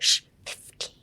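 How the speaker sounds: voiceless "shh" leading into a breathed "fifteen"; hushed huff. Shown as a demonstration. Teacher showing how to pronounce fifteen, hushed huff. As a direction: puff out the F of "fifteen" like a shush. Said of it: "That's how you should pronounce fifteen".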